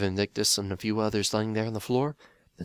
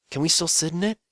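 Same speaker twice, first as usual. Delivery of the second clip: wary question; 10 semitones higher, 6 dB louder than usual. Acted. Phil asks wary.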